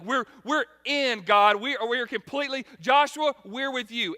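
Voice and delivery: hammed-up eager promise; excited crowd. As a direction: gush with commitment. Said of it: "everybody's like".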